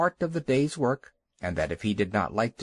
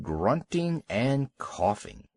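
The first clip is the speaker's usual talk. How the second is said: ordinary delivery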